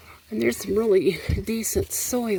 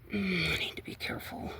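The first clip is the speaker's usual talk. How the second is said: quick careful whisper; 2 semitones lower, 10 dB quieter, faster than usual. Real, not acted. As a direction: whisper a warning to yourself. Cautions herself.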